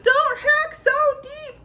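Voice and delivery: very high, loud, slow tiny scream; top-of-the-lungs high squeal. A narrator's reading. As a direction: scream it at the butcher from inside the meat. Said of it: "screamed at the top of his lungs".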